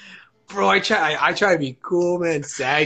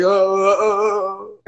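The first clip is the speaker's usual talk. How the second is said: embarrassed stammer; 6 semitones higher, 5 dB louder, much slower than usual. Retold or imitated.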